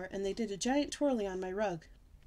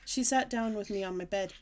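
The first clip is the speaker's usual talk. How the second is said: usual speaking voice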